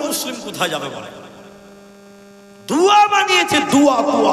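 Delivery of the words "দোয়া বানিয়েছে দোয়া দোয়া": very high, loud indignant cry; outraged shout. Real, not acted. Preacher shouting in outrage that the prayer was invented. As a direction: hurl it at the crowd in outrage.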